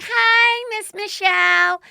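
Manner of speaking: high, squeaky chorus of six-year-olds greeting the teacher; little kids' voice. Retold or imitated. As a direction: pipe up like excited first graders. Kids greeting on Zoom class.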